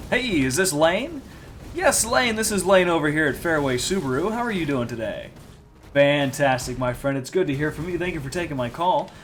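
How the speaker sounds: bright, fast game show host pitch; upbeat salesman on the phone; customer service voice. Retold or imitated. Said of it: "you should hear how I used to sound on the phone".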